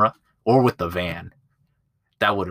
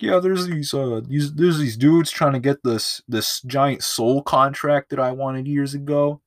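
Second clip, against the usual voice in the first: higher-pitched put-on southern accent; southern accent devil; 5 semitones higher than usual. Devil pitching Johnny a job, mock southern accent. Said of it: "giving the devil a southern accent".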